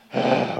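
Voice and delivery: big audible exhale; composing sigh. Retold or imitated. Newcomer composing himself before going home, heavy sigh. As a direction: breathe out hard to pull together.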